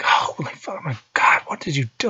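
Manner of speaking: winded, whispered disbelief; stunned whisper. Retold or imitated. Dad winded by his son's punch, stunned whisper. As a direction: gasp it out, winded.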